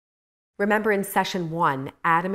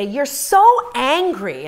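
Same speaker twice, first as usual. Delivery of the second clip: slow, higher, louder mocking of husband; husband's reproach; 8 semitones higher, 8 dB louder, much slower than usual. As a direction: drag out the reproach like her husband did. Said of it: "and he'd say".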